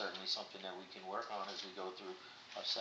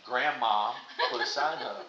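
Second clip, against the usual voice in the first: laughing; 5 semitones higher, 13 dB louder, slower than usual. Real, not acted. Therapist laughing while speaking.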